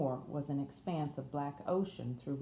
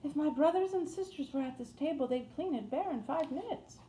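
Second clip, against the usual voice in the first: much higher-pitched Yorkshire housemaid; Yorkshire accent; 11 semitones higher than usual. A narrator's reading. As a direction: speak up high in broad Yorkshire. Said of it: "in a broad Yorkshire accent".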